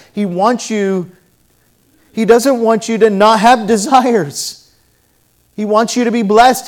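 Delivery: loud, high, emphatic preaching; fervent pulpit preaching; preaching at full force. Acted. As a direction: drive the sermon home to the congregation.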